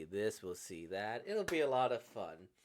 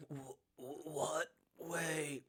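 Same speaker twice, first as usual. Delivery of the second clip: slow, halting, stunned question; taken aback, dragging out the words; stunned stammer; much slower than usual. Acted. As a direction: stammer it out in disbelief. Shocked character stammers the question.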